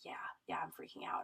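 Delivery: very low, near-whispered admission of panic; holding back tears. Retold or imitated. Scared patient admitting panic to clinician, trying not to cry. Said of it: "I'm trying not to cry".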